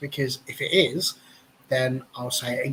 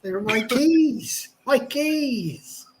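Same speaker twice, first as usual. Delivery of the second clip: put-on old farmer; 9 semitones higher, much slower than usual. Farmer voice bit.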